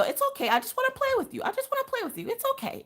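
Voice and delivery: high, soft squeaky-pitched dog voice; dog talking. Acted. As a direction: coo high and soft like a playful pup. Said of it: "licking the dog to let him know".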